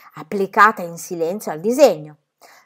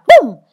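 loud, high "boom"; explosive voiced whack; imitated blow; 9 semitones higher, 16 dB louder than usual. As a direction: punch out the impact sound. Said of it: "lo colpii ancora una volta".

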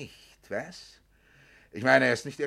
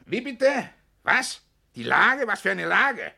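rising, louder, disbelieving questions fired one after another; startled outburst; 8 semitones higher, 7 dB louder, slower than usual. Acted. Kutzner on the phone to the doctor, startled and loud. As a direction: caught off guard, he demands to know what's meant.

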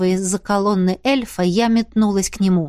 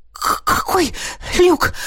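high, stammering gasps; winded, broken question; out-of-breath wheeze; 8 semitones higher, slower than usual. A narrator's reading. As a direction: pant between the words, fighting for air. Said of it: "просипела, отчаянно пытаясь отдышаться".

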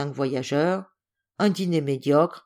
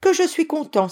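put-on high, louder cry of a happy little boy; gleeful, high-pitched relief; delighted exclamation; 12 semitones higher, 5 dB louder than usual. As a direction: burst out with childlike joy. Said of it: "s'écria Paul".

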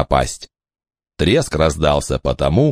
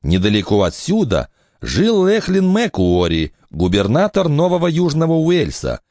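higher, lively woman's voice; mother's voice; 6 semitones higher than usual. Acted. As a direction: speak up bright as the mother. Eleanor tells history.

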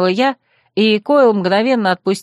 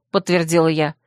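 ordinary delivery, nothing marked

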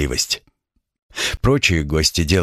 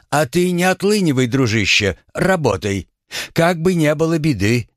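higher, hearty warning; 7 semitones higher than usual. Acted. Grimes warns Paul.

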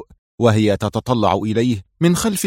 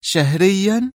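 much higher teasing question; half-sarcastic high query; half-mocking; 9 semitones higher, much slower than usual. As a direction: needle him with a mock-surprised lift. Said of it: "قالت في لهجة شبه ساخرة".

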